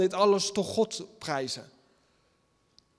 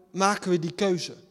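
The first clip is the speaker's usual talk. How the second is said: usual speaking voice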